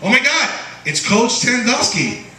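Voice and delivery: high, mock-thrilled gasp; mock kid excitement. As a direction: fake delight at a grim name. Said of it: "you grow up and you go like".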